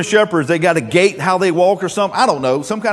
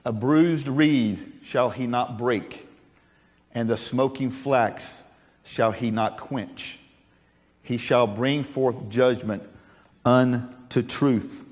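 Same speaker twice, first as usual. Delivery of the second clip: slow, soft, reverent reading; solemn measured recitation; scripture reading; 5 semitones lower, 4 dB quieter, slower than usual. Acted. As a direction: read the verse with reverence. Reads scripture.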